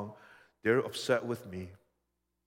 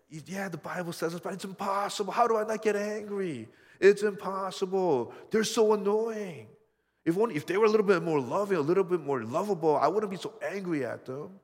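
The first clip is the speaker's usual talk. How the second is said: higher and louder, fed-up whine of excuses; exasperated complaint pitched up and pushed loud; mock exasperated rant; 7 semitones higher, 6 dB louder than usual. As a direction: play the annoyed believer venting. Voicing excuses for anger.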